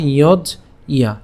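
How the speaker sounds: "ইয়জ ইয়া" slowly, closing on j before "ইয়া"; syllable-split pronunciation. Shown as a demonstration. Teacher breaks down the conjunct, slowly split "ইয়জ ইয়া". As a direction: break "ইয়জ ইয়া" into two slow parts, iyoj then iya. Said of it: "কীভাবে উচ্চারণ করছি".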